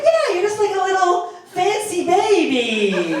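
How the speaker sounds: high, slow, drawn-out imitated coo; cooing woman. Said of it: "She said".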